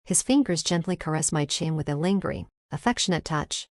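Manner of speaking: plain reading voice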